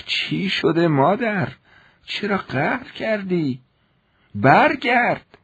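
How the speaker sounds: put-on high, slow old woman begging; old servant woman pleading in the street, high and slow; pleading old maid. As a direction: beg him to come back, slowly. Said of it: "با التماس گفت".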